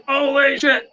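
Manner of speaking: high-pitched alarmed exclamation, acted; retold shock. Man hearing church door slam, retold high-pitched alarm.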